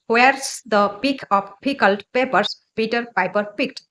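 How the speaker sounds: normal talking voice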